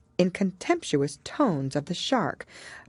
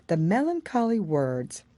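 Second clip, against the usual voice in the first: usual speaking voice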